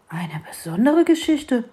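breathy, wondering whisper; surprised whisper. Acted. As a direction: hush it in astonishment. Mole surprised by request. (staunendes Flüstern)

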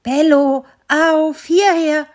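calling the dog. Acted. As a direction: shout for the dog from afar. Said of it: "schallte es durch den Winterweihnachtswald".

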